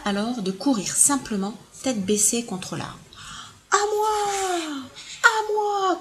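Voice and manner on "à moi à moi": high, eager shouting; all shout it out together; shouted claim. Acted. All the elephants shouting for the fruit, high and eager. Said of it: "crièrent tous les éléphants".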